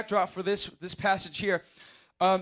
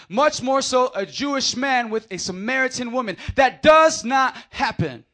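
preaching shout; 2 semitones higher, 5 dB louder than usual. Real, not acted. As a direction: drive the point home with a shout. Preaching emphasis.

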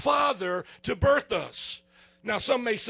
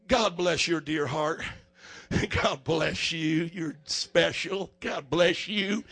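slow, syrupy Southern drawl, much lower; sweet Southern blessing; 5 semitones lower, slower than usual. Retold or imitated. Preacher mimics a Southern well-wisher, slow sugary Southern drawl. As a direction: pour on the sugary Southern charm. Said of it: "a little saying that goes".

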